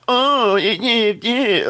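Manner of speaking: very high cutesy squeal; baby voice. Retold or imitated. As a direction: coo it in a babyish voice. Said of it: "when it's like".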